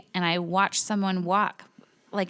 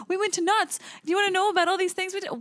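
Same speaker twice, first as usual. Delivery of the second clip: much higher, fast, cheerful; mocking Tony; 9 semitones higher, faster than usual. Imitating Tony bragging about Knott's, high fast excited. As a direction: rattle it off like a clueless kid. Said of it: "And you were like".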